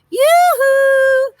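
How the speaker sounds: high, loud sing-song yoo-hoo; crying out. A narrator's reading. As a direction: hail the ducks from afar. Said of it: "she cried".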